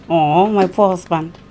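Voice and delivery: ordinary delivery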